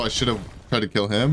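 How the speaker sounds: ordinary delivery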